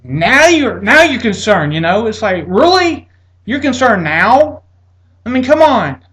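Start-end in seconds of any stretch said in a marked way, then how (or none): 0.0-1.7 louder, higher dig; sarcastic retort. Retold or imitated. To the suddenly alarmed doctor, loud sarcastic dig. 2.5-6.0 sarcastic disbelief. Retold or imitated. To the doctor now worried, loud high sarcastic disbelief.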